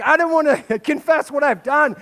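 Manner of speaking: high, loud dog voice through a laugh. Retold or imitated. Pastor voicing the dog refusing to confess, laughing. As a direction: voice the guilty dog while laughing. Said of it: "And she's like".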